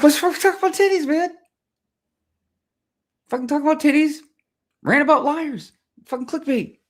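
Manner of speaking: very high, loud squeaky retort; mock indignation. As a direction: protest in an exaggerated high-pitched voice. Mock retort to imagined critics.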